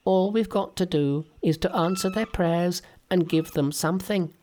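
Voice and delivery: very high, dismissive Monkey voice; Monkey waves off Sandy's worry, very high; Monkey whispering. Acted. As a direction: brush it off like a cheeky monkey. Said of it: "whispered Monkey".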